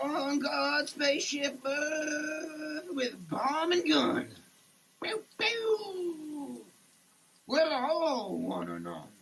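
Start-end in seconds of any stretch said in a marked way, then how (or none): none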